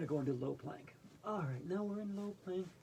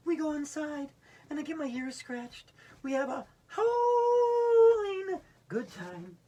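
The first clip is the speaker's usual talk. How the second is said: rising to a long high howl; howling dog; 9 semitones higher, 10 dB louder, slower than usual. Acted. Dog in the poem howling happily, loud and high. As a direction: end the poem with a big slow howl. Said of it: "recite Fetch with me".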